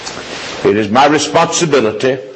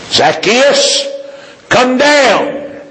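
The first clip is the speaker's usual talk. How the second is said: high, projected call to someone up above; 13 semitones higher, much slower than usual. Acted. Preacher voicing Jesus calling Zacchaeus down, high calling voice. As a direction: call the name out high, then the command higher still.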